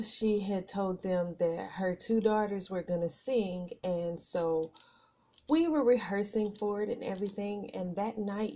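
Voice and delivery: ordinary talk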